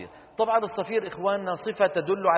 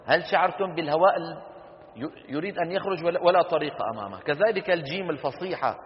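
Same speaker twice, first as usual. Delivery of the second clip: ordinary talk, nothing marked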